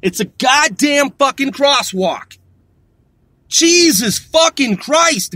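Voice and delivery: slow, emphatic angry cursing; real exasperation. Real, not acted. Driver fumes at pedestrian at crosswalk, slow and exasperated. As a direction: curse word by word, dragging it out.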